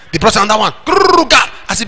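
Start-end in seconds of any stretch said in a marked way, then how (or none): 0.8-1.5 high rolled growl with a hard end; imitated bottle noise. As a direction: roll a grrr into a short ga.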